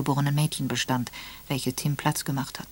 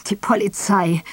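bitter; 4 semitones higher, 5 dB louder than usual. Acted. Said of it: "Tim lachte bitter".

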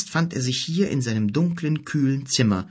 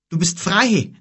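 overjoyed; 8 semitones higher, 4 dB louder than usual. A narrator's reading. Kim hugs his healed sister.

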